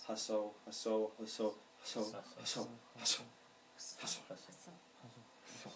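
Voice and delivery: haso repeated rapidly, fading to breath; fast repetition. Shown as a demonstration. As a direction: say haso over and over quickly until the voice thins out. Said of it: "You have to say fast".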